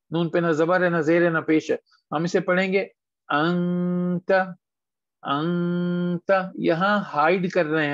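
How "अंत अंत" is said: drawn-out nasal hum in अंत before the final ta; nasal ikhfa. Shown as a demonstration. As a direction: hold the noon of अंत long, hidden in the nose. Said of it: "हम छुपा रहे हैं जी".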